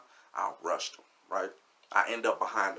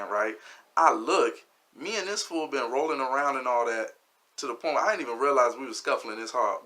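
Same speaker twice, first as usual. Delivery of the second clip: usual speaking voice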